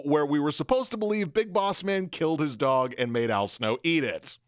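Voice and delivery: ordinary talk, nothing marked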